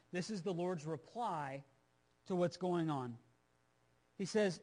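usual speaking voice

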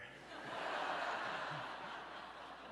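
soft breathy laugh; a quiet laugh at the memory; real chuckle. Real, not acted. Dad laughing as he retells his son's fall.

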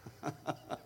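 a soft breathless laugh at himself; quiet unvoiced chuckle; breathy laugh. Real, not acted.

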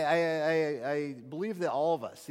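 ordinary talk, nothing marked

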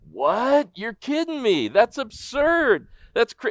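high disbelieving outburst, stretched slow on absurd; mock the crowd's stunned disbelief; incredulous crowd. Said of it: "everyone just went".

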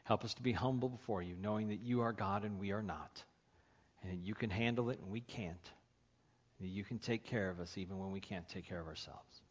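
soft, low closing prayer; quiet prayer. Real, not acted. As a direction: pray gently over the congregation.

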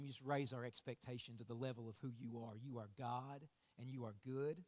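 low, earnest prayer voice. Real, not acted. Preacher praying to Father over the room before the message, prayer.

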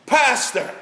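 a high, loud bellow down the phone. Retold or imitated. Desperate caller bellows for the pastor at night, loud. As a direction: belt the name out like a man at noon. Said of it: "the voice bellows down the phone".

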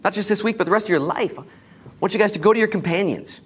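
loud, high, rising sermon call; preaching. Acted. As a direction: build the exhortation with fire. Rising exhortation to the congregation.

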